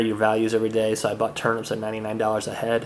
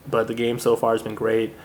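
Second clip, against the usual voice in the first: usual speaking voice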